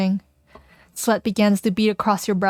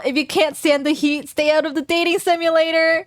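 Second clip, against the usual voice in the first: high announcer voice; 7 semitones higher than usual. Acted. Mock game announcer voice.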